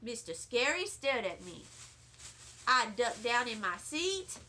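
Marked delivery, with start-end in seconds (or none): none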